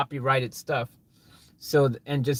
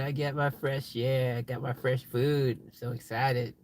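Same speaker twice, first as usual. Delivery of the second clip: voicing the car crew; 4 semitones lower, 6 dB quieter than usual. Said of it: "They're like".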